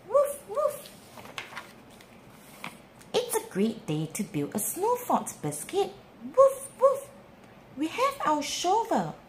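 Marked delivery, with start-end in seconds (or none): 0.1-0.9 high, playful puppy woofs; yappy little dog voice; puppy barks. Acted. Storyteller voices the puppy Biscuit. 6.4-7.1 high, playful puppy woofs; yappy little dog voice; puppy barks. Acted. Storyteller voices the puppy Biscuit.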